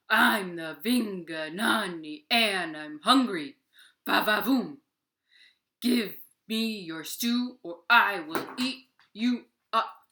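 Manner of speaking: loud, slow monster growl demanding stew; booming hungry monster. Acted. Vinganani threatening Deer for his stew, loud slow monster voice. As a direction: boom it out like a greedy giant.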